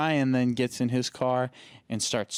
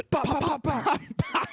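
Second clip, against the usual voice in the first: mock pistol shots.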